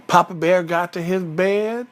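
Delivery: plain reading voice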